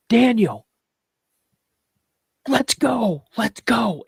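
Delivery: high-pitched mock shout down the road; retold urgent yell. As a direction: imitate a brother calling out, high and loud. Retold brother calling out from afar.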